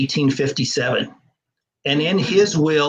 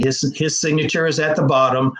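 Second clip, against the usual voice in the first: ordinary delivery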